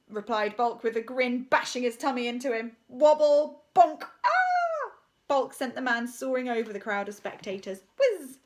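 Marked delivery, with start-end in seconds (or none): 2.9-4.9 loud sound effects ending in a high yelp; comic high cry; wobble bonk and yelp. A narrator's reading. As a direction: yelp as he flies. Man bashed and flung.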